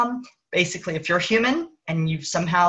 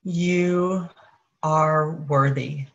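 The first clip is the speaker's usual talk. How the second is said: higher consciousness voice. Acted. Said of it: "the voice of higher consciousness".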